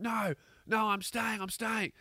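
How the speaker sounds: high voice without extra volume; high mock protest. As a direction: protest as if being dragged away. Woman resists being dragged off.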